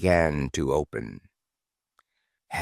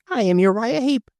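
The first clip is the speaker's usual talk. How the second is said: high voice; 14 semitones higher than usual. Acted. Voices announcing themselves.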